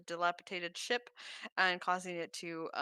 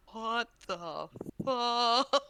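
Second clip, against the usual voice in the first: slow disbelief; 4 semitones higher, much slower than usual. Acted. Dwarves stare at Thalassa.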